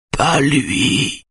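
high, thin, wavering voice of terror; shrill terror. A narrator's reading. Schulman answers Simone, shrill and terrified. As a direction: squeeze it out high in dread. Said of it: "d'une voix suraiguë et remplie de terreur".

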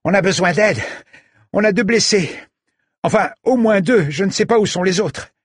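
high, desperate shouting; calling for help. Acted. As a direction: shout for help across the park. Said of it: "Ginny ... cria".

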